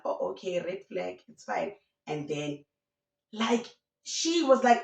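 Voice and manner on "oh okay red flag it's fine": resigned aside. Retold or imitated. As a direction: drop it down as if to yourself. Acts out hurt, withdrawn private reaction.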